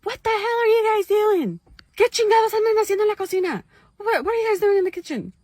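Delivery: loud, high, fast angry yell; dad screaming. Retold or imitated. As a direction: bellow at the kids from the bedroom. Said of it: "my dad starts screaming".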